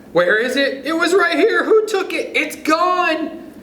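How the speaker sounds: frantic search for something lost; panicky high voice. Acted. Child who cannot find the book, panicking high.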